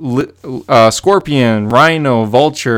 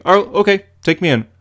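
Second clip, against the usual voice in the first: high mock agreeable tone; sarcastic; 7 semitones higher, faster than usual. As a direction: say it sarcastically, unbothered. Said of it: "he's just like".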